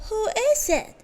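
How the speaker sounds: put-on high old granny voice; grandmother's voice. A narrator's reading. Grandmother in bed answering the knock, high voice. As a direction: call out high like a frail grandma. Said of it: "came a voice from inside".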